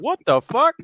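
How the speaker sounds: high put-on voice of a shocked wife. Ex-wife reacts to the smell, high and shocked. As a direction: recoil and ask, high.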